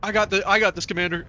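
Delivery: eager soldier. Acted. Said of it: "his, uh".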